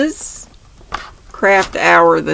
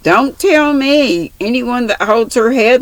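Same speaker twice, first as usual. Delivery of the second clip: scornful retort; 6 semitones higher than usual. Acted. Mary contradicts Una.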